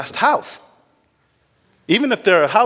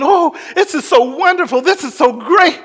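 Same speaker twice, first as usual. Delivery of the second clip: higher and louder, overjoyed; joyful laughing; 10 semitones higher, 6 dB louder than usual. Retold or imitated. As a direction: gush with happy laughter. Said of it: "Here he's laughing".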